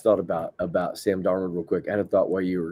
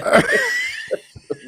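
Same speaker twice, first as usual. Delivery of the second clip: high cackling laugh; 4 dB louder than usual. Retold or imitated. After the impression.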